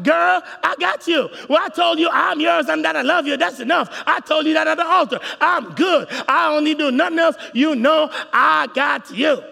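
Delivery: loud, high dismissive husband shouting; shouted husband insisting he's said it all; brushing-off husband. Retold or imitated. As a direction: bellow it like a smug husband who thinks he's done enough. Husband voiced brushing wife off.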